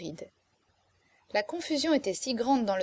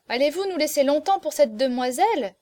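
higher, louder, pointed question; impatient question; 5 semitones higher, 5 dB louder than usual. A narrator's reading. Lady guest demanding an answer about the girl, impatient question. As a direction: press them with a raised voice.

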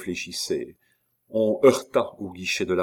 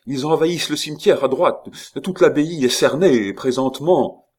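high, loud, urgent news; raised-voice warning; alarmed report; 7 semitones higher, 6 dB louder than usual. A narrator's reading. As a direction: blurt out the danger, pitched up. Reporting the abbey surrounded.

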